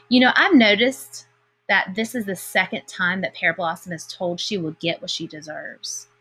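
plain reading voice

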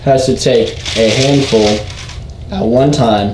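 loud hyped host announcement; announcer voice. Acted. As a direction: address the camera loudly. Announcing final round to viewers.

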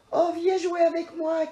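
high, loud eager cry; the cub begging her to play; squeaky excited cub voice; little bear calling out. Acted. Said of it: "crie petit ours".

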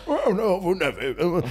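lightly voiced high old grandfather; a hint of a frail old man; old grandpa voice. Shown as a demonstration. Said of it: "je faisais un grand-père".

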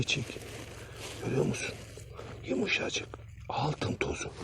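unvoiced whisper, close and intimate; hushed whisper. Real, not acted. Showing the cameraman the gold dust, an awed whisper. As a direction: whisper as if not to disturb it.

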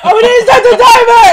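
loud laughing realization; laughing shout. Real, not acted. Realizes mistake mid challenge.

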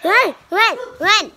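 loud, high urgent shout. Real, not acted. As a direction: yell at him to flee. Urging survivor to flee.

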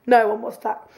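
usual speaking voice

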